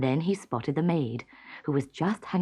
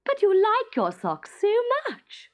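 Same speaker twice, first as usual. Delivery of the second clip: high, teasing mock innocence; a queen gloating over her trick; smirking; 3 dB louder than usual. A narrator's reading. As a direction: tease him sweetly. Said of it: "smirked the queen".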